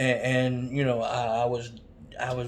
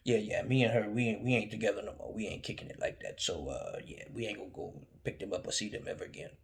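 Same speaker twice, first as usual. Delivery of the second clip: low, much softer, fading to a whisper; dad breaking bad news under his breath; quiet dad; 4 semitones lower, 8 dB quieter, faster than usual. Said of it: "He said".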